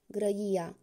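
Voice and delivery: usual speaking voice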